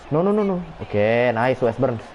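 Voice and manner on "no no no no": rising alarmed burst of no's. Real, not acted. Streamer watching his player miss, alarmed. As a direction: panic as the shot goes wrong. Reacting to a missed chance.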